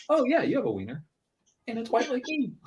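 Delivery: put-on high-pitched little kid voice; young son. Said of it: "and then he goes".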